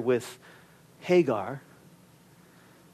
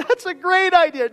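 high mock-sarcastic quip, much higher pitch; 10 semitones higher than usual. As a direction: toss it off like an ironic joke. Mock reply to own question.

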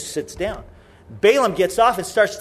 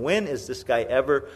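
low, soft exasperated musing; quiet, resigned complaint; weary disbelief; 8 semitones lower, 7 dB quieter than usual. Acted. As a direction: wonder aloud with a shake of the head. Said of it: "God's just shaking his head".

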